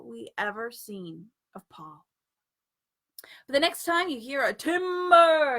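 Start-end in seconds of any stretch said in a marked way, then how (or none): 4.5-5.6 loud, long lumberjack call; yell. A narrator's reading. A yell from hell, loud and drawn out. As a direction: bellow it from the pits.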